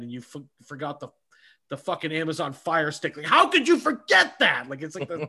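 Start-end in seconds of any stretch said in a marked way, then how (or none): 3.2-4.7 higher, exasperated mock outrage. Complaining about a partner who forgot the Fire Stick, mock outrage. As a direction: act stunned by the forgotten item.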